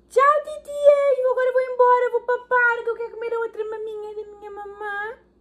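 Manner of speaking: high, pretend baby voice; baby's voice. Mother speaks as her baby saying goodbye, high baby voice.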